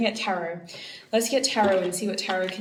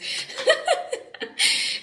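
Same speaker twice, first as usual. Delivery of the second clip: 1 high breathy giggle. Real, not acted. Laughing at her own slip.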